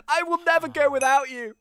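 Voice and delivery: high-pitched melodramatic vow; hammed-up emotional declaration; defiant hero. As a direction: declare loyalty with mock heroism. Hero refuses to leave captive.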